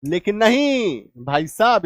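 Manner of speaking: usual speaking voice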